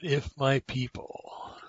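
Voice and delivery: slow, whispered murmur to oneself; half-voiced, drawn-out whisper; murmuring while typing. Real, not acted. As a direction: whisper it slowly as you type it in. Mouthing search words while typing.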